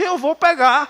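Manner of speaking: put-on a boy insisting to his aunt, childish; stubborn child. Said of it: "Eu disse".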